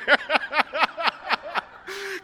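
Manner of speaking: high cackling laugh at himself; loud burst of laughter. Real, not acted. Pastor laughing at his own story, loud laugh.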